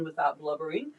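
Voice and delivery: ordinary talk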